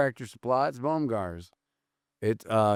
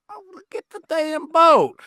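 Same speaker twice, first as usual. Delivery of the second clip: much higher, loud, slowed Southern drawl; Southern drawl impression; 13 semitones higher, 3 dB louder than usual. Joking about pontoon talk down south, in a loud mock Southern drawl. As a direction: ham up a Southern guy scoffing at a pontoon. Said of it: "what's the difference when you say pontoon?".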